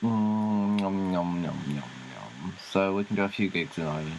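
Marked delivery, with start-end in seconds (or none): 1.4-2.5 soft, low munching nom noise; mock munching. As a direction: mime chomping food quietly.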